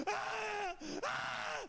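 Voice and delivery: high, repeated imitated shrieks; acted screaming in short bursts; mock scream. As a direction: shriek like the friend mid-trip. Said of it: "stop screaming, for fuck's sake".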